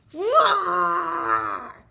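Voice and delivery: loud grumpy bear roar; growling bear roar at the goslings. Acted. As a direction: let out a big cross roar.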